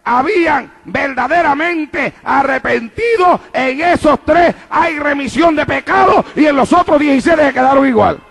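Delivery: loud, emphatic preaching; prédica a plena voz. Real, not acted. Predica a plena fuerza. (predicando con fuerza)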